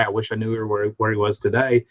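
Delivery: ordinary talk, nothing marked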